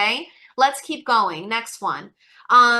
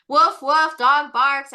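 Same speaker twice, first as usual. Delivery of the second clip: louder playful woof woof; mock dog bark; 5 dB louder, slower than usual. Teacher imitating a dog bark for the class. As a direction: bark it for the kids.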